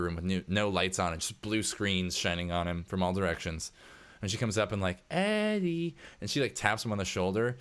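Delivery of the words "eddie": drawn-out, high-pitched name call; high singled-out call. Acted. Worker coming up behind her absorbed boss, high coaxing call. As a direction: coax him to notice you. Calling him again up close.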